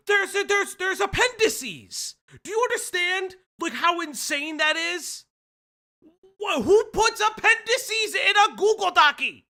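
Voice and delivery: very high, loud disbelief; shrieked, exasperated astonishment; incredulous outburst. Real, not acted. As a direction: squeal it in baffled outrage. Incredulous rant about appendices.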